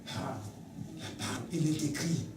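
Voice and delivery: faint murmur. Acted. Voicing a faint recalled scripture.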